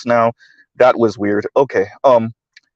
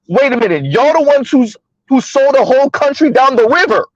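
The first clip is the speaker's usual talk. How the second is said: heated rant; 9 semitones higher than usual. Real, not acted. Ranting at conservative men.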